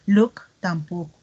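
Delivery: normal talking voice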